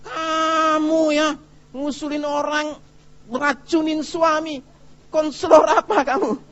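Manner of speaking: very high, drawn-out indignant scolding; hammed-up high-pitched telling-off; angry wife. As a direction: act out an outraged wife telling off the counsellor. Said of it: "Dia marah sama saya".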